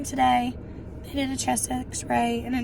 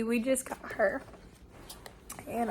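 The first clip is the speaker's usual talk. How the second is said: ordinary delivery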